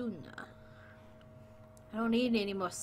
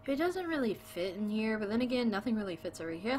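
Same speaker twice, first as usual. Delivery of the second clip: normal talking voice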